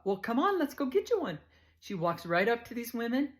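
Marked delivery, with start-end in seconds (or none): 0.0-1.4 higher, louder friendly big-woman voice; Too Tall's greeting. Retold or imitated. Too Tall greets the new girl, loud and friendly.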